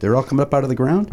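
ordinary talk, nothing marked

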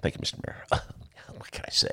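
softly whispered thank-you; mock whisper. Retelling thanking the mayor, hushed whisper. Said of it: "my Harold Washington imitation".

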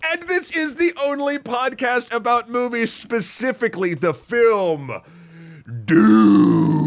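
slow, booming trailer voice; announcer voice. Acted. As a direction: build to a loud reveal on Dune. Announcer closes the intro.

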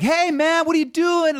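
high, loud, buddy-buddy fan call; excited fan greeting. Retold or imitated. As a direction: shout it like a fan who knows him. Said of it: "with Mike, like".

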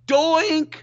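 high, loud playful yell of Doink!. Retold or imitated. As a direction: shout Doink! to jinx the kick. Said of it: "she yells out".

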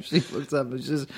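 ordinary talk

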